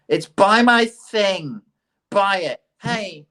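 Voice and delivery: mock salesman, pitched high and slowed; hammed-up advertiser pushing his product; pushy ad voice. Mocking ads that push you to buy.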